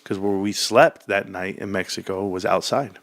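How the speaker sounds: ordinary talk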